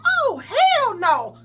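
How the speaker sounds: high, drawn-out indignant refusal; mock-appalled, stretched-out exclamation; outraged disbelief. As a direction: draw it out high and slow, appalled. Cousin's outraged reaction acted out.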